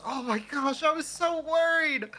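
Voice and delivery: put-on high-pitched child, gushing with relief; relieved kid. Kid hugging the rescued cat, high relieved cry. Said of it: "Just says".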